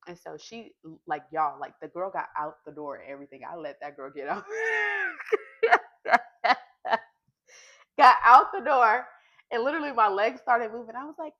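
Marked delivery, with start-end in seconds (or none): 4.5-7.0 cracking up at her own stubbornness; loud high laugh. Real, not acted. Laughing about letting her go.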